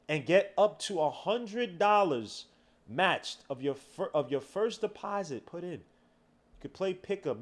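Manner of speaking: ad read. Acted. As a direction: sell it to the viewers. Sponsor ad read.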